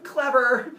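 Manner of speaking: "clever" said loud and high, pleased with himself; smug demo. Shown as a demonstration. Teacher demonstrating the husband's smug greeting. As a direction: show how he thinks he's clever. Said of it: "he answers the phone with".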